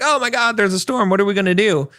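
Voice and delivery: high, mock-panicked crew voice. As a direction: imitate a frightened crew member fretting. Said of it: "and they're like".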